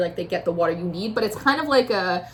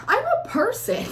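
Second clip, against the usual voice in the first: sighing, high-pitched protest; sigh, then plead it with a laugh underneath; exasperated plea; 13 semitones higher than usual. Acted. Tarot reader voicing the querent, exasperated high plea. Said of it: "that's what it feels like, your energy".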